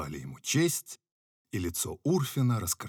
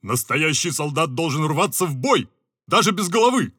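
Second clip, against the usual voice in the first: bold soldier's retort, higher and louder; a general insisting with swagger; bravely objecting; 8 semitones higher, 9 dB louder than usual. Acted. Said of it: "браво возразил Лан Перот". (браво, громко возражая)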